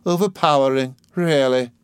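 mild sneering irony; sarcastic. Acted. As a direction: jab with sarcasm, lightly voiced. Miss Murdstone sarcastic.

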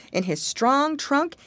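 ordinary delivery, nothing marked

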